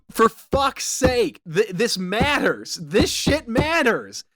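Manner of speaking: slow, weighted, exasperated stress on every word; frustrated emphasis. Real, not acted. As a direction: lean hard on "matters", drawn out. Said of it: "But it was very frustrating".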